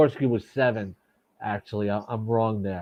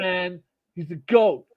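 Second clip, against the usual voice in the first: put-on Boston accent; 7 semitones higher, slower than usual. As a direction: say it like a proud Boston local. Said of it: "I can't say it in my Boston accent".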